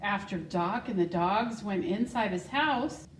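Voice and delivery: put-on reading a picture book to children; story reading. School secretary reading Wonder Horse to the class, storytelling voice. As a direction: read it warmly and clearly to young listeners.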